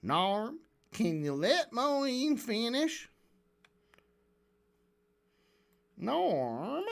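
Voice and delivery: cartoonish southern drawl, slow and swooping; Lex Friedman. Host giving the moderator a silly voice, cartoonish southern drawl. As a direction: exaggerate a silly southern accent, stretching each vowel. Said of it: "cartoonishly southern accent".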